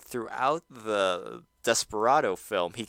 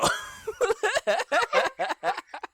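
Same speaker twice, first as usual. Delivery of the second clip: high burst of laughter. Real, not acted. Cracking up at own take.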